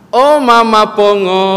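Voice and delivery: loud, high and slow call. Retold or imitated. Husband calls his deaf wife from the middle room, loudly. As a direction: bellow it through the house.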